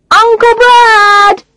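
put-on high, loud child's shout. A narrator's reading. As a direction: yell it at the top of your lungs. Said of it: "shouts Christopher at the top of his voice".

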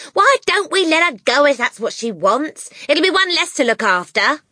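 high, loud, careless wife; offhand mother voice. A narrator's reading. Mother happy to leave daughter behind, offhand and loud. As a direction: dismiss the child without a care.

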